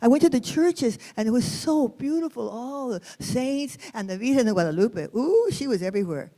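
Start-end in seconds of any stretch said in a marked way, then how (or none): none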